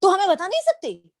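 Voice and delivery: imitating a high-pitched scolding. Imitating the didi scolding her for staying silent. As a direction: replay the didi's sharp scolding question. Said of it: "उन्होंने मुझे इतना डांटा".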